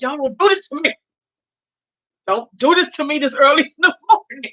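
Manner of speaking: mock-distressed pleading; retold tearful plea. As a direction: beg them, half joking. Retold plea while trying not to cry.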